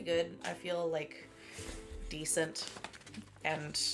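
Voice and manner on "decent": normal talking voice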